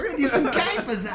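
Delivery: high, loud mock-Maori accent; mimicked Maori accent. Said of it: "a guy that sounded like that".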